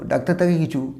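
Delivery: ordinary delivery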